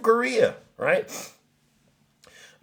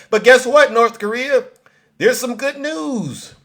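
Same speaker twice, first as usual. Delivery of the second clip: mock taunt; 10 semitones higher, 7 dB louder than usual. Mocking taunt to North Korea.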